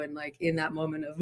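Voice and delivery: ordinary talk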